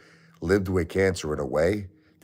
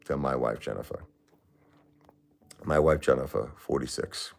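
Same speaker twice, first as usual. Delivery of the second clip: trailing off softly as grief takes over; grief-choked; 3 semitones lower, 4 dB quieter than usual. Real, not acted. Grieving widower on stream names his wife, voice sinking. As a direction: voice sinking on his wife's name.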